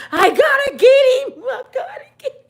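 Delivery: high, laughing fake sob; mock sobbing. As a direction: wail it like she's losing it. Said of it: "She's slobbing".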